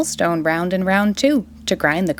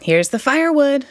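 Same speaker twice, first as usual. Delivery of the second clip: put-on higher, bright boyish call; excited shout; 6 semitones higher than usual. A narrator's reading. Jack proudly brings the egg, excited shout. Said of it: "shouted Jack".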